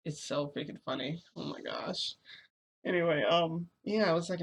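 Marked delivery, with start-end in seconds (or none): none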